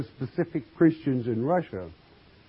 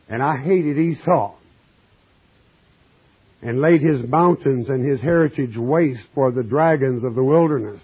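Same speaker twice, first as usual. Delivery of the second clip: louder, measured pulpit reading; scripture reading; 4 dB louder than usual. Acted. Preacher reading scripture to his congregation, declaiming. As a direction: read the verse out to the flock.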